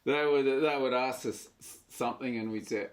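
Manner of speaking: ordinary delivery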